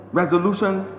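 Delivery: ordinary delivery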